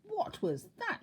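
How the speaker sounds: very high squeaky shriek; frightened shriek. A narrator's reading. Mister Jelly hearing the tramp snore, high frightened shriek. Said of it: "shrieked Mister Jelly".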